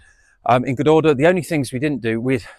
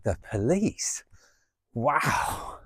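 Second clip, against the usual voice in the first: incredulous; 2 semitones higher, 6 dB quieter, much slower than usual. Real, not acted. Mocking the police threat.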